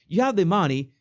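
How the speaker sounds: ordinary talk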